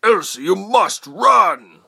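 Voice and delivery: high, loud, very drawn-out father's yell; yelling over wind. A narrator's reading. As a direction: bellow slowly to carry through wind. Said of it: "her father yelled above the wind".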